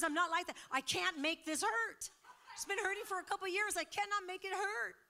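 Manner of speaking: loud, high-pitched imitation of incredulity; retold amazement. Woman who can't make her foot hurt, retold amazed protest.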